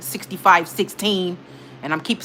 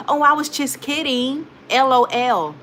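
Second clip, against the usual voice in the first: higher, drawn-out mock of a joker; mocking excuse; 5 semitones higher, slower than usual. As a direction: imitate a fake-innocent excuse in a raised voice. Mimicking rude people's excuse.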